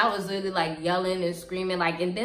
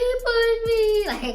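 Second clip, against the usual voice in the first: loud, high, drawn-out mock wail; hammed-up tearful tattling; tattling child; 5 dB louder, much slower than usual. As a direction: whine it slowly like a crying snitch. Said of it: "I was a snitch".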